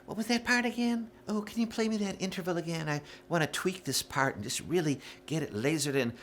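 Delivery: put-on high little voice, mocking; clueless choir singer. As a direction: imitate an unprepared singer asking sweetly. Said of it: "they come in and they're like".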